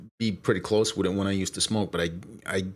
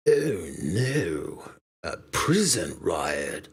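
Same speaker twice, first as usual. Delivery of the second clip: drawn-out cartoon criminal voice; slow, swooping jailbird character; Snake; 3 semitones lower, much slower than usual. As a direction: stretch it out like a cartoon crook. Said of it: "Snake from The Simpsons".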